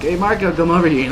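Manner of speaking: imitated drunken shout-over; calling out to a friend. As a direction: holler a buddy over, loud and high. Drunk teen calls friend over.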